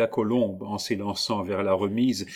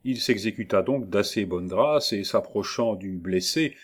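plain reading voice

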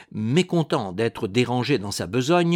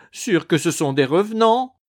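high, loud wailing lament; 6 semitones higher, 5 dB louder than usual. A narrator's reading. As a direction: wail it out in fear. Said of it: "lamentait Ursule".

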